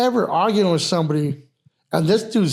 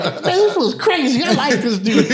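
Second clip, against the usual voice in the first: high, laughing imitation; amused amazement; 8 semitones higher, 3 dB louder than usual. Bouncer imitating the player won over by his joke, laughing amazement. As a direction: say it through a laugh, pitched up high.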